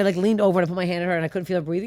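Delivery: usual speaking voice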